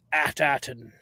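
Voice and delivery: low, hushed pirate growl. Pretend. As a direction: say it lightly like a pirate, low and soft. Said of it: "a pirate voice".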